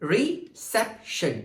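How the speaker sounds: "reception" very slow, a gap after each syllable. Shown as a demonstration. Teacher showing three syllables, "reception" slowly broken apart. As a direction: split "reception" into re, cep, tion. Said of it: "So we have three syllables".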